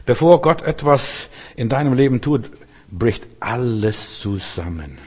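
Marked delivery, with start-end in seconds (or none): 2.9-5.0 lower, softer and slow, letting it fall apart; sinking collapse. Acted. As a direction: let the words crumble downward. Preacher describes everything collapsing. (leise und langsam, zusammenbrechend)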